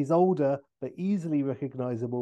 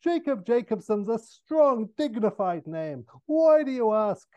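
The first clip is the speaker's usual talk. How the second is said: much higher, louder exasperated burst; very impatient; 9 semitones higher, 7 dB louder than usual. Acted. O'Brien defending another double name, very impatient. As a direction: snap it out at the end of your patience. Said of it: "And very impatient now".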